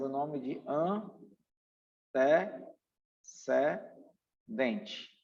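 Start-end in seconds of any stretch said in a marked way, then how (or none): none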